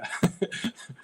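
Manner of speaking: quick unvoiced giggle; breathy laugh. Real, not acted. Laughing at turd pun.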